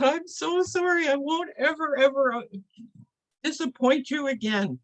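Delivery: high-pitched pleading promise; sad, upset apology. Acted. Helper promises never to disappoint medicine man, tearful pleading. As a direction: beg forgiveness in a high, upset voice. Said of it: "it was just really sad".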